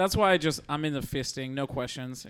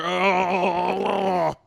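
monster voice; 4 semitones higher, 4 dB louder than usual. Pretend. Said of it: "And then the monster".

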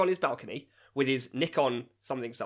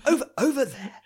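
high, loud mock American accent; 12 semitones higher, 7 dB louder than usual. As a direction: put on an exaggerated American accent. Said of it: "in an American accent".

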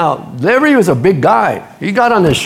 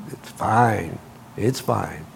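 soft reassurance; 10 semitones lower, 11 dB quieter, much slower than usual. Real, not acted. Preacher reassuring, fading.

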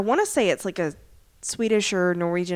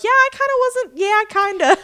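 higher, louder playful Peggy voice; mock Peggy; 11 semitones higher, 5 dB louder than usual. Friend quoting Peggy's cheeky reply, higher mock voice. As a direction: play Peggy teasing back lightly. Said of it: "And Peggy was like".

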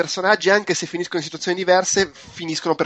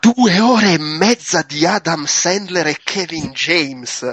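slow and louder, every word weighted; emphatic italian speech; 2 semitones higher, 4 dB louder, much slower than usual. Real, not acted. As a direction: spell out the outrage word by word. Outraged at a long runtime.

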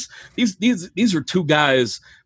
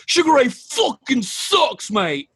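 high, slow Manchester jeer; Mancunian accent; 9 semitones higher, slower than usual. Retold or imitated. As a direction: sneer it out like a Mancunian heckler. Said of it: "And someone".

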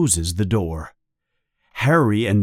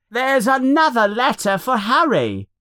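high-pitched announcement to the house; child's yell; 13 semitones higher than usual. A narrator's reading. Dudley tells his parents about the mail, high yell. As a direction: call it out high, like a kid tattling. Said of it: "he yells to his parents".

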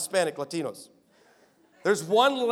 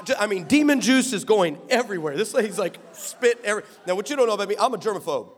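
normal talking voice

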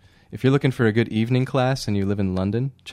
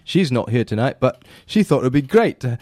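a hint of a bad Scottish-Irish accent, louder; 3 semitones higher, 4 dB louder than usual. Host reading a banker's quote in a bad Scottish-Irish accent. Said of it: "You just went into a Scottish-Irish accent".

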